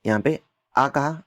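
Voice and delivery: ordinary delivery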